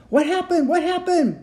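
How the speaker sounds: high, loud frantic shouts; panicked yelling. A narrator's reading. Passengers flee down the corridor, yelling high and loud. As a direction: yell in panic after the blast. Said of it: "screaming and yelling".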